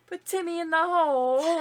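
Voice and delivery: loud, high bad British voice; bad British accent. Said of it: "it was bad".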